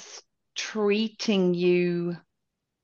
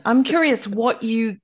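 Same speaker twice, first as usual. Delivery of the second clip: ordinary delivery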